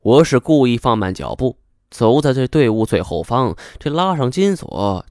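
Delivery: ordinary delivery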